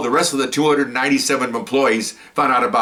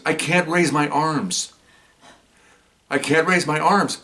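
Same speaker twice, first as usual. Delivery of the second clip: slow, drawn-out retold complaint, pitched up; helpless complaint; 4 semitones higher, slower than usual. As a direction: imitate someone helpless, stretching the words.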